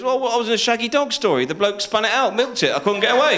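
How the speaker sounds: high-pitched protesting excuse; acted indignant self-defence; defensive excuse. Latecomer defends himself.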